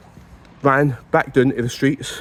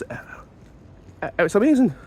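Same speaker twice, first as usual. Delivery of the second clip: ordinary talk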